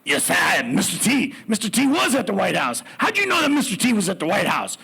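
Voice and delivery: big, high, shouty third-person boast; Mister T. Retold or imitated. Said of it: "referring to this person in his third-person voice".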